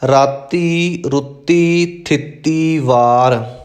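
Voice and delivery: ਥਿੱਤੀ said aspirated th first, then plain t; correct pronunciation. Shown as a demonstration. Teacher corrects a common misreading, careful pronunciation. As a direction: say ਥਿੱਤੀ as th-itti, never ਤਿੱਥੀ. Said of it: "ਥੱਥਾ ਪਹਿਲਾਂ ਲਾਉਣਾ ਹੈ".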